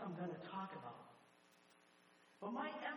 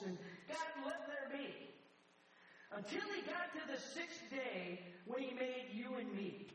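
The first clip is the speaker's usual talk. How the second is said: preaching at full force; 9 semitones higher than usual. Acted. Pastor preaching creation to the congregation, fervent high preaching. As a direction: build the fire as God creates man.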